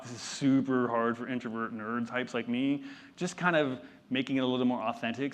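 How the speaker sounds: normal talking voice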